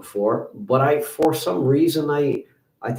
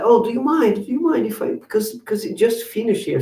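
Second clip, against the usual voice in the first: much higher-pitched imitated woman; imitated polite request; 12 semitones higher than usual. Woman asking driver to let her in, high-pitched pleading. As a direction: plead lightly in a high borrowed voice.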